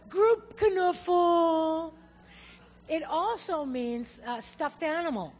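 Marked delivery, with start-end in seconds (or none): 0.1-1.9 loud, high, long-drawn call; mock rallying cry, drawn out slowly; playful calling out. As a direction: stretch it out as if summoning everyone for a hug. Calling out for a group hug.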